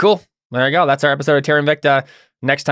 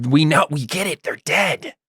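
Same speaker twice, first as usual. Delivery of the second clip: higher-pitched, mock-exasperated noble complaint; exasperated fop; 4 semitones higher than usual. Game master voices complaining noble fop.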